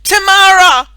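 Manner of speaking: very high, very loud yell of a name. A narrator's reading. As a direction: shout her name across the playground. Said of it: "she yelled".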